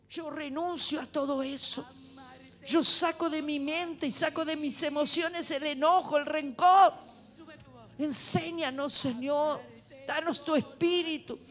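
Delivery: loud, impassioned sermon; fervent preaching. Acted. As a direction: preach with rising fire. Preaches at full force.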